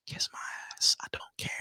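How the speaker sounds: whispered, barely audible retort; whispered aside. Pretend. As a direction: whisper it under your breath. Muttered aside after being challenged.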